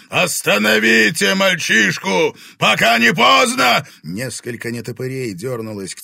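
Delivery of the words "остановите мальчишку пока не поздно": very high, loud command; desperate shout. A narrator's reading. Dragon ordering the boy stopped, desperate shout. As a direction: scream the order to your minions. Дракон в панике кричит приспешникам.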